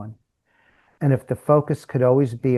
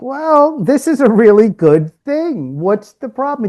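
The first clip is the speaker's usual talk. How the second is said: bright, much higher, upbeat coaxing; upbeat encouragement; 9 semitones higher, 6 dB louder than usual. Retold or imitated. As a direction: cheer him on, pitched way up. Coach cheering up sulking student.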